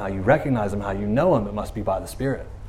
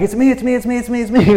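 eager reassurance; 7 semitones higher than usual. Pretend. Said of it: "He's like".

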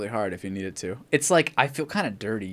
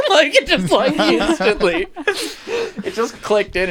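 much higher and louder through real laughter, slowing at the end; laughing; 8 dB louder, much slower than usual. Real, not acted. Laughing while telling the story.

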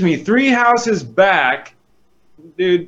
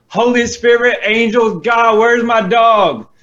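desperate cry to God; 8 semitones higher, 3 dB louder than usual. Retold or imitated. Desperate prayer while searching for dog.

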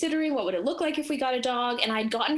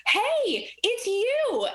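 high, louder gushing "dog" voice; high-pitched happy hello; delighted dog greeting; 13 semitones higher, 3 dB louder, much slower than usual. Retold or imitated. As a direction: voice an overjoyed dog spotting a friend. Voicing the delighted dog greeting her.

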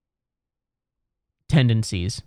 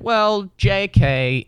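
mock dismissive; 7 semitones higher, much slower than usual. His reply dismissing the app.

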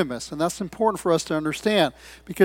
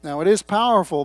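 ordinary talk, nothing marked

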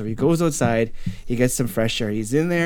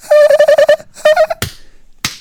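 loud, very high cackle; imitated laughter; 8 dB louder than usual. Laughter over the attack, loud high cackle. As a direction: manic mock giggle. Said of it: "it's all you start hearing".

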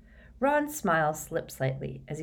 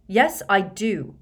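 prompt retort; 5 semitones higher, 6 dB louder, slower than usual. A narrator's reading. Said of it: "said Harry at once".

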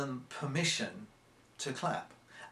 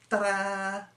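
a higher, louder, hammed-up showman's flourish; mock stagey ta-da; 8 semitones higher, 4 dB louder than usual. Shown as a demonstration. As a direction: sell the trick's ending with tongue-in-cheek pomp. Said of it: "done in a slightly mocking way".

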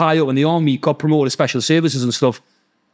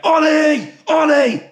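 high-pitched mock shout for the dog; imitated dog call; 12 semitones higher, much slower than usual. Imitating the neighbour calling his dog, high mock shout. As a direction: yell the name up high, as if summoning a dog.

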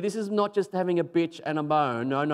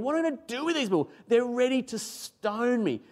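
high, hurried frustrated cry, slowing at the end; exasperated, pitch thrown up high; Moses; 10 semitones higher than usual. Acted. Preacher replaying a leader's complaint to God, frustrated. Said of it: "you can hear the frustration in his voice".

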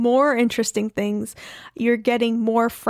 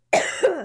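coughing fit; 6 semitones higher than usual. Real, not acted. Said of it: "My eggnog went down the wrong throat".

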